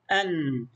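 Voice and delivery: أن as "an" with a lingering n; isolated syllable أن, closed on a held nun; fatha tanween. Shown as a demonstration. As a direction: let the nun ring at the end of أن. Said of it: "نون ساكنة".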